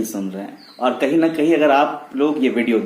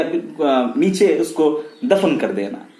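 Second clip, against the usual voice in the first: normal talking voice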